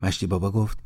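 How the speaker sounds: ordinary delivery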